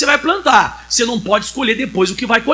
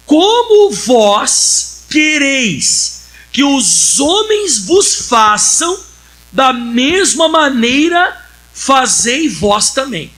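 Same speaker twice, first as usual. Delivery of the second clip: slow, louder, drawn-out Bible verse; solemn scripture reading; 3 semitones higher, 5 dB louder, much slower than usual. Acted. Preacher reading Jesus's words to the congregation, slow and loud. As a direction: proclaim each word of the verse slowly.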